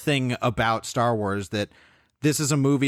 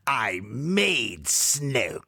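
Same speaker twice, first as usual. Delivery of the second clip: Emperor impression; 3 semitones lower, much slower than usual. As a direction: drag it out like a sinister emperor. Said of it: "the Emperor delivers the line".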